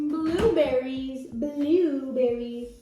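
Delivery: slow word modelling. Real, not acted. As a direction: stretch "Blueberries" out slowly.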